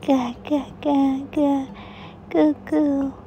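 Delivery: put-on high sing-song baby talk; cooing goo-goo baby talk. Mother baby-talking to infant.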